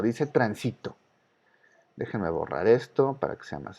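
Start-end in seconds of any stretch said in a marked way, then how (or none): 0.3-1.0 "tránsito" stressed in the middle, tran-SI-to; acento grave. Shown as a demonstration. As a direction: lean on SI in "tránsito", not TRAN. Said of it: "Una palabra grave". ("tránsito" con fuerza en la penúltima, tran-SI-to)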